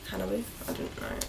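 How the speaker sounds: Hanabi? in an Australian accent, HAN-uh-bee; Australian accent, flat a in Hanabi?. Shown as a demonstration. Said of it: "I'm saying it in an Australian accent".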